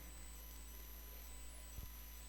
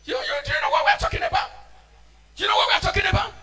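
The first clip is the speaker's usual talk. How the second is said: shouted, higher, fast rhetorical question; shouted challenge; 9 semitones higher, 4 dB louder, faster than usual. Real, not acted. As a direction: call them out, loud and high. Preacher challenging congregation.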